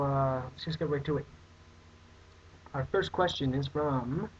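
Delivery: ordinary talk, nothing marked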